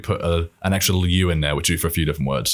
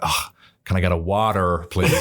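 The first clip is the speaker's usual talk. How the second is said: put-on American accent. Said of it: "I'm like".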